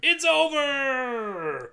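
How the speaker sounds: high, very long drawn-out call; playful, elongated closing cry; mock grand finale. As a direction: announce the ending with a stretched last word. Announcing the film's ending.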